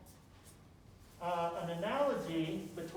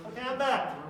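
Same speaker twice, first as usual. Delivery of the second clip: louder, brighter return call; loud announcement; 4 semitones higher, 7 dB louder than usual. Real, not acted. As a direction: announce your return to the room. Back up after tying shoe.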